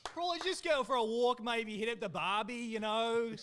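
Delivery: loud, relaxed put-on Australian accent; confident mock-Australian accent. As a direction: lay on a broad Aussie accent. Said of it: "in your best Australian accent".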